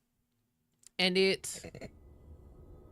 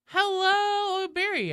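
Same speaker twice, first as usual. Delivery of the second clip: much higher, loud sing-song hello; high pitched greeting; 12 semitones higher, 5 dB louder, slower than usual. Real, not acted. Streamer greeting Barry in a high sing-song. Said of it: "voice is just getting increasingly high pitched".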